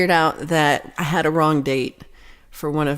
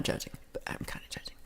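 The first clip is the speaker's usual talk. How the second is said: sudden breathy whisper, half to herself; whispered aside; 17 dB quieter, faster than usual. Real, not acted. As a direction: drop to a secret whisper for the confession. Guilty aside about judging woman.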